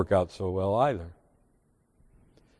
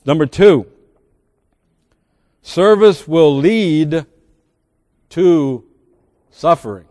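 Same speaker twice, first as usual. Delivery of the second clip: slow, emphatic sermon point; preaching; 4 semitones higher, 7 dB louder, slower than usual. Acted. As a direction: lay the point down slowly for the church. Preacher states his point.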